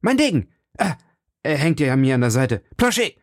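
frantic high call for his servant; urgent cries. A narrator's reading. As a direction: scramble for the sword in haste. D'Artagnan rushes to fight.

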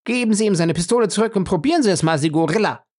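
high, rapid scornful challenge; defiant woman's jeer; contemptuous. A narrator's reading. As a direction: fire off the taunt fast and high. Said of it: "erwiderte sie verächtlich".

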